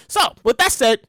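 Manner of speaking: normal talking voice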